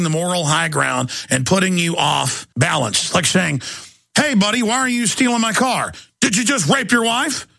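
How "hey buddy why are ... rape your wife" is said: higher mock indignant voice; lightly voiced mock outrage; acted quote. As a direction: play both sides of the absurd exchange. Acted car thief exchange.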